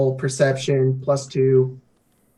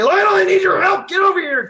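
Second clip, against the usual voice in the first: high, loud shout across the fight; urgent battle call to a friend; calling for help; 10 semitones higher, 3 dB louder than usual. Acted. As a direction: yell to an ally to come help. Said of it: "and I say".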